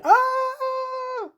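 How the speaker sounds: very high, drawn-out mock death wail; hammed-up vampire death shriek; dying scream. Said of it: "They're, like, dying".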